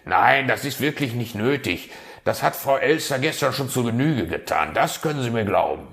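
hasty refusal. Acted. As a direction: rush to wave the raven off. Fox hastily refuses help.

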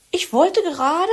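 high Frau Elster. A narrator's reading. Elster interrupted.

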